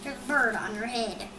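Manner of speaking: usual speaking voice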